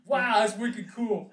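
slow, dopey Boston drawl; Boston accent. Pretend. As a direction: play a dim Boston bro. Mocking Austin at the finale.

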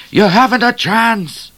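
high, loud, slow hold-up call; bushranger's voice. A narrator's reading. Hidden bushranger to Midnight at gunpoint, loud and high. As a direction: call it out as a threat. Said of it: "said the voice".

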